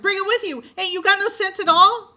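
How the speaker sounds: high, loud exasperated shout; a brother yelling at his sister's lack of sense; angry yell. A narrator's reading. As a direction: yell in frustration. Jem keeps hollering at Scout.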